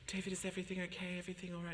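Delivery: anxious mum. Retold or imitated. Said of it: "She's like".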